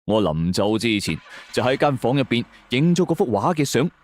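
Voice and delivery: ordinary delivery, nothing marked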